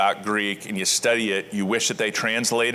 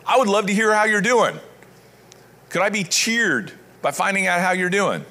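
higher, louder, eager voice of Paul; 8 semitones higher, 3 dB louder than usual. Acted. Paul writing to the church he misses, eager and hopeful. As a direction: sound like news of them would make your day. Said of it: "And he would make my day".

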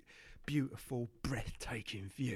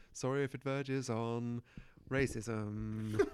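ordinary talk

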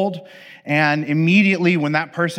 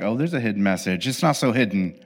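knowing; 7 semitones lower, 5 dB quieter, faster than usual. Retold or imitated. Everyone retorts knowingly.

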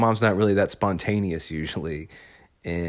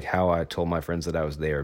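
normal talking voice

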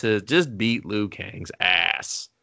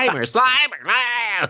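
high, louder goofy calling; dim-witted Slimer; 13 semitones higher, 3 dB louder than usual. Retold or imitated. Imitating cartoon Slimer's dumb voice, high goofy yelp. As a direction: yelp the name like a simpleton. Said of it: "he sounded like he only had two brain cells".

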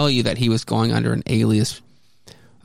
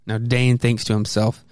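ordinary delivery, nothing marked

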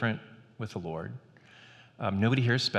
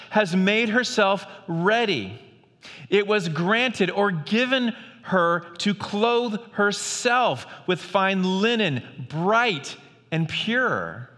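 scripture reading; 7 semitones higher, slower than usual. Acted. Pastor reading Revelation to the church, proclaiming. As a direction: proclaim the text like a herald.

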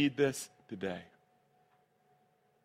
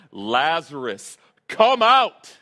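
loud voice; 10 dB louder, much slower than usual. Retold or imitated. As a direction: call a dead man out of his tomb at full volume. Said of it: "says in a loud voice".

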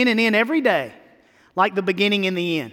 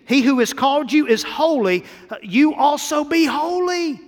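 scripture proclaimed; 7 semitones higher, 4 dB louder than usual. Real, not acted. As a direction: proclaim the verse up high and loud. Reading scripture with force.

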